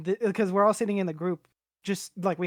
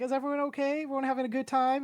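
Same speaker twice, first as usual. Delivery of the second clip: higher-pitched voice imitating a friend's sister; friend's sister checking in; 7 semitones higher, faster than usual.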